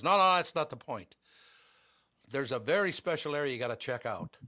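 insistent Irish cabbie, lightly imitated; attempted Irish accent. Taxi driver correcting his passenger, attempted Irish accent. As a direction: press the point with a touch of Irish. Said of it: "the way the taxi driver did".

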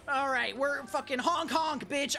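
normal talking voice

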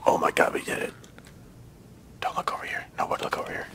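tense, soft whispering; breath-only stealthy relief and worry; hushed whisper. Real, not acted. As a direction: whisper so nobody notices you. Hiding after a kill.

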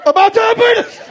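"diabetes" blurted very high and loud as "dia-beetus"; sudden high, loud "diabetes" said "dia-beetus"; Wilford Brimley. Retold or imitated. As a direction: jump way up and blast "diabetes", ending it in "us". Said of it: "why he says it that way".

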